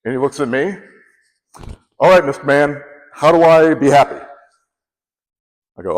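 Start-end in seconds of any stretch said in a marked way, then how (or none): 2.0-4.2 louder, punchy retold demand; brusque executive demand. Executive demands a lesson in happiness from his guest, brusque. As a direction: act out a boss demanding an answer.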